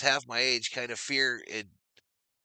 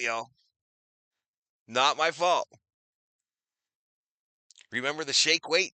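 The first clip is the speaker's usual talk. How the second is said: ordinary talk, nothing marked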